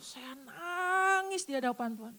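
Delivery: ordinary delivery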